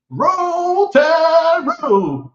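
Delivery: high, loud, sung-out cheer; imitating Stephen A Smith's Roll Tide, a bit too high and loud. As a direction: belt the chant up high like a TV pundit. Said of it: "That's what he said".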